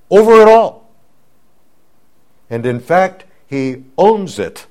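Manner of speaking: loud preaching that peaks in a high cry on "over it all"; the preacher proclaims Christ's authority to his hearers, preaching loud; full-force preaching. Acted. Proclaim it, soaring at the end.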